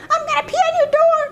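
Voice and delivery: very high, rushed squealed threat; rowdy little boy. Retold or imitated. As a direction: rattle it out like a naughty small boy. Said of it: "you hear the boys".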